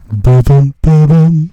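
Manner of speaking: low, loud booming Monokuma; Monokuma voice line. Retold or imitated. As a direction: boom it out low like the bear. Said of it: "He comes on and is like".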